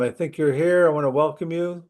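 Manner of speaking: normal talking voice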